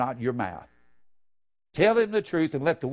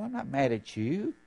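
normal talking voice

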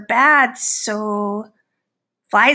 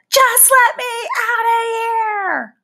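desperate plea; 10 semitones higher than usual. Acted. Fly trapped in the class habitat, high pleading cry. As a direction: beg to be freed.

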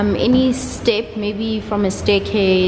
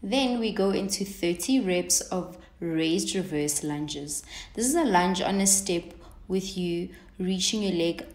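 voiceover read; 11 dB quieter than usual. Acted. Recorded voiceover instructions.

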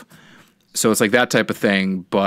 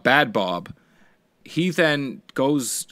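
ordinary talk, nothing marked

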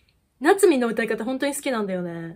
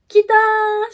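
much higher and louder cry of joy; excited cheer; 10 semitones higher, 9 dB louder, much slower than usual. Real, not acted. Fan cheers as her favourite member appears, excited cry. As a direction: shout it out, thrilled.

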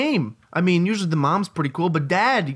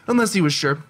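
normal talking voice

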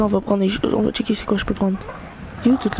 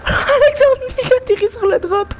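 very high, loud, laughing squeal; laughing excitedly; 3 dB louder than usual. Real, not acted. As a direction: burst out laughing mid-sentence. Atterrit sur le drop, rit. (rire aigu et excité)

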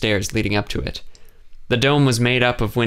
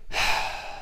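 long unvoiced sigh; 7 dB quieter than usual. A narrator's reading. Kay sighs before answering the question, a tired sigh. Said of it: "She sighed".

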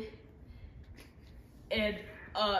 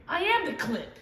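shouting; 5 semitones higher, 6 dB louder than usual. Acted. Screamed punchline in rap.

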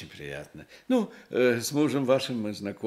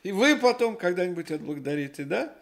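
higher and louder, pressing the gift on them; eager insistence; 10 semitones higher, 5 dB louder than usual. Acted. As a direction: wave the payment away eagerly. Shoemaker presses gift, refuses money. (настойчиво и громко уговаривает взять подарок)

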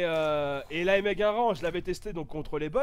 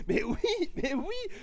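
laughing; 8 semitones higher, much slower than usual. Real, not acted. Laughing sarcasm.

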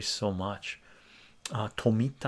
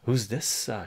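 usual speaking voice

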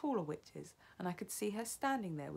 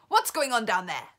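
loud, raised demand; commanding shout; 5 semitones higher, 12 dB louder than usual. A narrator's reading. Grand High Witch calling from the platform, shouting. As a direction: shout down at unruly underlings. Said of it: "shouted the Grand High Witch".

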